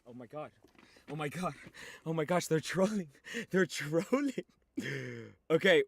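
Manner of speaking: normal talking voice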